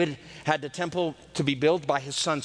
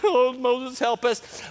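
mock pleading people; 6 semitones higher, slower than usual. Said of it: "they're all dying".

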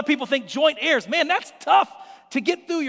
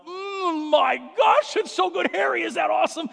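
hammed-up delight; 5 semitones higher than usual. Eating cookie in front of kids.

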